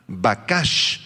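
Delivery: Bakash, slow, stressed on the final syllable; stress on second A. Shown as a demonstration. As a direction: draw out Bakash as ba-KASH. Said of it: "acento en la segunda A".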